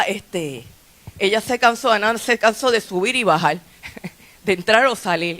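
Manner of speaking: ordinary delivery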